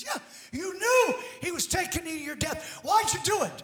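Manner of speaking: second bystander challenging. Retold or imitated. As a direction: throw the question out like a heckler. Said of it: "Somebody else goes".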